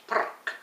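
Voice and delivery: frrrk with a soft rolled Scottish R; frrrk, R trilled like kirk. Shown as a demonstration. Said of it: "Like a little bit Scottish".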